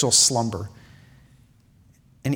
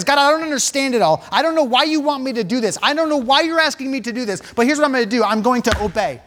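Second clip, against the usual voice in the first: fervent preaching; 8 semitones higher than usual. Acted. Preacher urging the church to obey, at full force. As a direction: lift it up and drive it home to the congregation.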